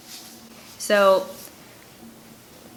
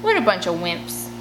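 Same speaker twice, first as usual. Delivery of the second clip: calm and smug. Acted. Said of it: "More calm than he should be".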